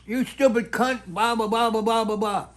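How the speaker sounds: high mock-angry tirade; retold screaming. Snowplow guy about to kill her, imitated angry yelling. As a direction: rant on one high pitch, imitating a row. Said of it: "screaming at each other".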